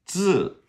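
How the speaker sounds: "字" as buzzing dz, high falling tone; zi sound demo. Shown as a demonstration. Teacher showing new phonetics, high falling buzzed "字". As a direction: hit "字" high with a buzzed dz, then drop. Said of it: "is pronounced Z I".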